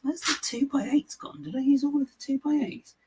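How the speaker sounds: very high, quiet mock bewilderment; imitated puzzled self. Mocking oneself hunting for missing two-by-eights, high puzzled voice. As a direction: act out your puzzled self in a high, silly voice. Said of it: "I'm like".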